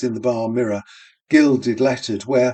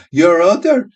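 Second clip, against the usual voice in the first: much louder, higher screaming laugh; 7 semitones higher, 9 dB louder than usual. A narrator's reading. Barmaids screaming with laughter at each other. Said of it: "laughter screaming".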